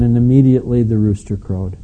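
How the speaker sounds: ordinary talk, nothing marked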